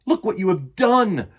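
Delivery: loud, high angry outburst; angry shout. Acted. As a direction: yell at the goat in fury. Facile angry at goat.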